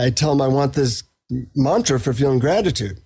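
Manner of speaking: usual speaking voice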